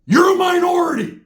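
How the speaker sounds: very high, loud yell imitating an angry man; mock shouted insult. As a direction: shout it in his face, far above normal pitch. Acted insult shouted in a quarrel.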